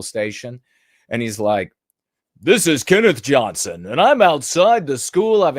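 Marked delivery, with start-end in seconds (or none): 2.4-5.6 higher, louder stiff TV reporter delivery; weird newsreader voice. Retold or imitated. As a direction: ape a local news reporter's put-on cadence. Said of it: "that same weird talking".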